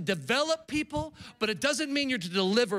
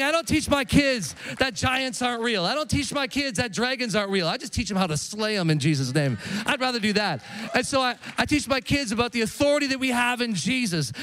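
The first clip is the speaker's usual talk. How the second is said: full-force preaching, louder; 5 dB louder than usual. Real, not acted. Preacher rousing the congregation, loud and forceful. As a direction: preach it hard to the room.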